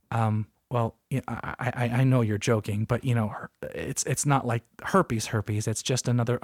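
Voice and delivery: blank humorless doctor. Retold or imitated. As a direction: play it dead serious and a little lost for words. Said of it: "looks at me kinda blankly and goes".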